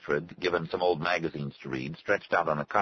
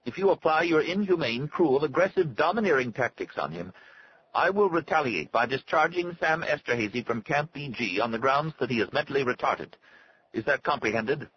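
high, stilted, threatening doctor; thin, unnatural voice; 9 semitones higher than usual. A narrator's reading. Doctor threatens retaliation over the phone, thin unnatural voice. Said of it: "in a thin, unnatural voice".

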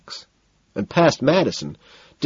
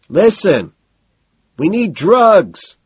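high, loud, slowing yell; drawn-out high holler; losing his cool, shouting; 9 semitones higher, 7 dB louder, slower than usual. A narrator's reading. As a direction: shout an urgent demand over the music. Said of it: "I think I hear him shout".

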